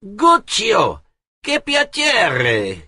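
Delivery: high, loud, drawn-out Italian greeting; glad cry. A narrator's reading. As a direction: cry out with joy at an old friend. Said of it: "he cried".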